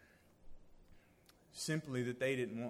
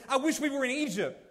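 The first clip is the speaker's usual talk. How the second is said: high, loud mock whine; whining complaint; 11 semitones higher, 14 dB louder than usual. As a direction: whine about Egypt like the grumbling people. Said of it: "they whined and complained and said".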